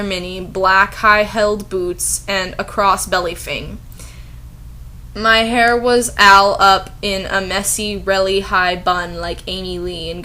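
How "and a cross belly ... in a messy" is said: normal talking voice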